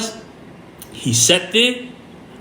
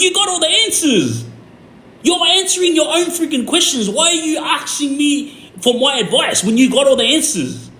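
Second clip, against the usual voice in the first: very high-pitched, incredulous outburst; exasperated; 11 semitones higher than usual. Retold or imitated. Telling a friend he already has all the answers, exasperated. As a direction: plead in exasperation, voice pitched right up. Confronting friend in retold argument.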